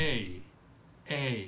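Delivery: এই said as a clear drawn-out ay sound; the letter A spoken as এই; pronunciation demo. Shown as a demonstration. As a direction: model এই slowly for the class. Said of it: "সঠিক উচ্চারণটা হচ্ছে".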